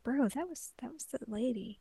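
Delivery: deadpan aside. Retold or imitated. Cohost telling Moroku the truth, soft deadpan. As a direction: mutter it drily. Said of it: "It's like".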